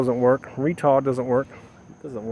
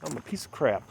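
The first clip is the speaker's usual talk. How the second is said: quiet despair; 4 dB quieter than usual. Retold or imitated. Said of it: "Is that what she said?".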